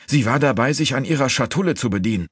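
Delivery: high, insistent report; urgent accusation. A narrator's reading. Sneaton reporting the thief to his master, high and urgent.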